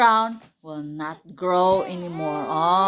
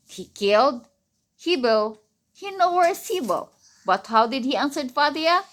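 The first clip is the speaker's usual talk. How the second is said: normal talking voice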